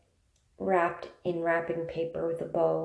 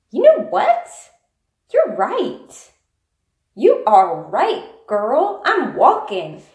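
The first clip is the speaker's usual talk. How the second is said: much higher, loud proud exclaiming; excited realization; 6 semitones higher, 10 dB louder, much slower than usual. Retold or imitated. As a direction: burst out with sudden pride. Person suddenly proud and positive.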